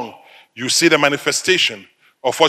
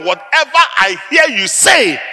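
tag forceful quote; 7 semitones higher than usual. Retold or imitated. Quoting God forcefully to congregation.

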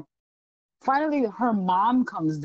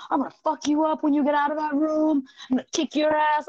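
mock threat; 6 semitones higher than usual. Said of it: "this woman's now threatening me".

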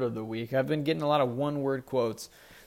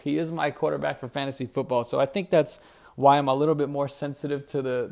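ordinary delivery

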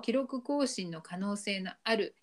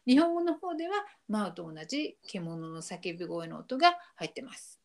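normal talking voice

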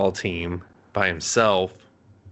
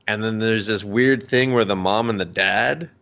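ordinary delivery

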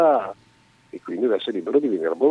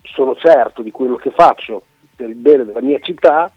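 louder, higher proud declaration; confident mayor; 5 semitones higher, 9 dB louder than usual. Retold or imitated. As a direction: proclaim it like a sure mayor. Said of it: "Se mi avesse detto".

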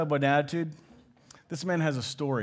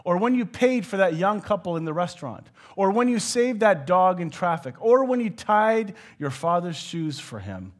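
voice answering; 5 semitones higher, 5 dB louder than usual. Retold or imitated. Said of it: "said the voice".

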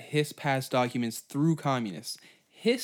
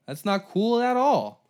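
"That's not cool at all" higher and louder, slow; louder emphatic repeat; 8 semitones higher, 5 dB louder than usual. Retold or imitated. Americans reacting to spies, louder outraged repeat.

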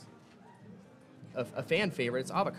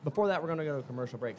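ordinary talk, nothing marked

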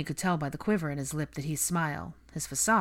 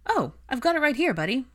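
put-on high, loud, jolly reply to a child; forced cheer; 10 semitones higher, 7 dB louder than usual. A narrator's reading. George pretends to have the boy's surprise, cheerily. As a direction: brighten right up for the kid.

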